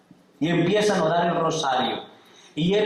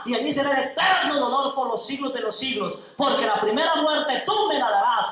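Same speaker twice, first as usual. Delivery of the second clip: rises much higher into a shouted warning of hell; building fiery preaching; 8 semitones higher than usual. Acted. Captive warns his kidnapper of hell, building to a shout. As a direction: preach it harder and higher until you shout.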